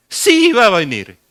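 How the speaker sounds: forceful preacher's rebuttal; emphatic answer. Real, not acted. Preacher answers his own question forcefully.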